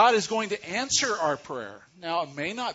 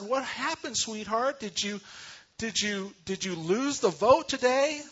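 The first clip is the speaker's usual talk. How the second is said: higher, a hint of a worried mother; concerned mom; 6 semitones higher than usual. Mother asking her tearful daughter what happened, lightly concerned. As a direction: ask gently like a mom to her child.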